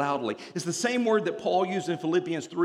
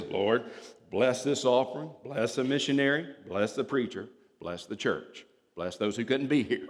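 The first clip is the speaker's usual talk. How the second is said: routine offering prayer; 4 semitones lower than usual. Retold or imitated. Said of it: "what he normally says".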